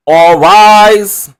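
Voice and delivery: loud, drawn-out courtroom call; bailiff's call. Acted.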